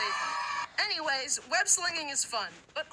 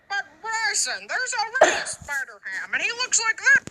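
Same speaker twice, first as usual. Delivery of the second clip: high cartoon voice; 7 semitones higher, 6 dB louder than usual. Another character interrupts Bryson.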